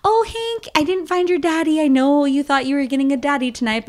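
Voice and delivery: high cooing pet voice; baby talk to dog. Acted. Talking to her dog Hank after a date, high baby talk. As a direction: coo to your dog like a baby. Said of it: "I was like".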